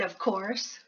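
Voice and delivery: plain reading voice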